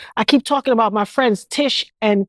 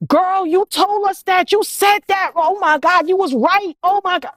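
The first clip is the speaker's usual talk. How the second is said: high, gushing mock amazement; mock amazed friends; 8 semitones higher than usual. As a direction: ham up the friends' sudden belief, pitched high. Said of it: "guess who was the first people to call me".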